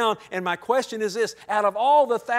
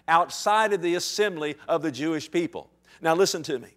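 ordinary talk, nothing marked

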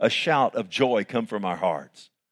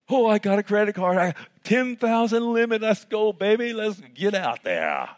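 giddy spender; 7 semitones higher than usual. Retold or imitated. Imitating someone thrilled about a credit card, high and fast. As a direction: brag like you just got rich.

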